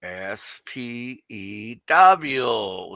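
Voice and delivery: "S P E W" spelled letter by letter, very slowly. Shown as a demonstration. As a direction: spell out each letter of "S P E W" like a teacher. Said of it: "That's how you say it".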